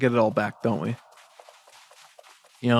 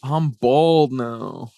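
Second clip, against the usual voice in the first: slow lament; 4 dB louder, much slower than usual. Real, not acted. Drawn out lament.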